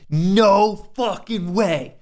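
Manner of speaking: slow, high, drawn-out disbelief; stunned disbelief. Real, not acted. Streamer watching his trade win, slow stunned disbelief. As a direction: let the disbelief stretch each word. Trade wins against the odds.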